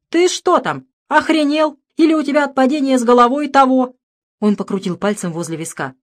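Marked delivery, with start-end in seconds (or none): 0.1-4.0 насмешливое недоверие. Acted. Валентин не верит другу.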